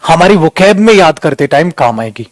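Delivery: normal talking voice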